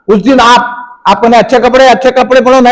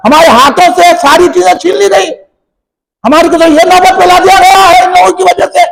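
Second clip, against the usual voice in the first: impassioned preaching; 4 semitones higher than usual. Acted. Impassioned grievance at full force.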